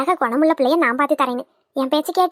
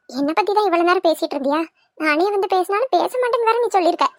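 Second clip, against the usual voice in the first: ordinary delivery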